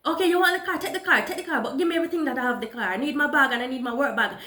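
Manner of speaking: retold shouted plea for her work bag; shouting. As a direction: shout at the carjacker for the bags. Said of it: "when I shouted at him".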